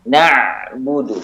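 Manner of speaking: very slow "Na'budu", the ain flowing briefly; slight flow on ain. Shown as a demonstration. Teacher demonstrating the ain for students, slowly. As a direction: let the ain of "Na'budu" flow just a little. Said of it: "ada suara yang mengalir namun sedikit".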